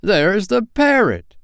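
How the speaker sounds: high, loud, drawn-out shout of delight; excited cry. A narrator's reading. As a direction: cry out thrilled at the sight. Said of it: "cried Scrooge".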